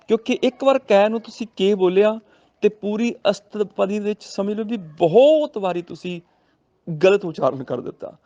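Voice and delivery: normal talking voice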